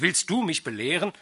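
loud, fast angry scolding; angry shout. A narrator's reading. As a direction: snap at the idler. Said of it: "rief Pinais erbost".

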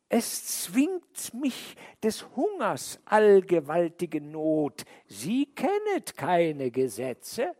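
high, slow snake voice with an odd timbre; drawn-out serpent excuse; sly snake voice. A narrator's reading. The snake pleads hunger to the man, high and slow.

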